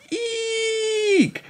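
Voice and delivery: very high, drawn-out eek; ear-piercing shriek. A narrator's reading. Emily finding something in her bag, piercing shriek. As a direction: squeal at the top of the range. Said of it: "an ear-piercing shriek".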